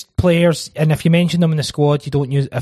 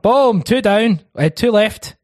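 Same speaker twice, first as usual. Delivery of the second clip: excited; 8 semitones higher, 3 dB louder, slower than usual. Real, not acted. Quiz host celebrating answer.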